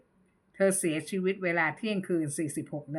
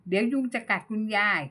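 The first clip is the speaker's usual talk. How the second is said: ordinary delivery, nothing marked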